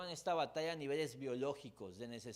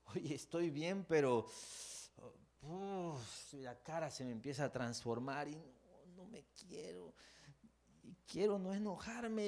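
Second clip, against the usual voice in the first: strained believer; 5 semitones higher than usual. Retold or imitated. Voices someone fighting anger.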